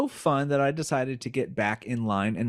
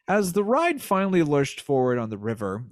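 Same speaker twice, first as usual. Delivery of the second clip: usual speaking voice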